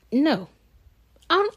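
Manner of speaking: ordinary talk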